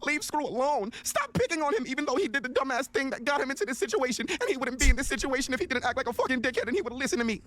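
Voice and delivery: very high-pitched, overwrought mock appeal; hammed-up high-pitched outcry; mock pleading defence. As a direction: plead his case in a squeaky high mocking voice. Mocking the post demanded of him.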